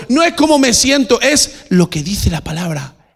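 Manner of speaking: high, loud insistent declaration; full-force pulpit emphasis; impassioned preaching. Real, not acted. Preacher climaxes on conviction.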